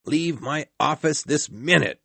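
angry shout. A narrator's reading. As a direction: shout him out of the office. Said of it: "shouted Scrooge".

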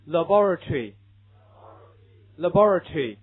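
"laboratory" the British way, slow and loud, stress on BOR; 英式发音，放慢清楚; British pronunciation. Shown as a demonstration. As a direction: read "laboratory" like a British teacher. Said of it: "英式读法".

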